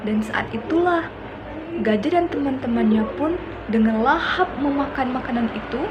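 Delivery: ordinary delivery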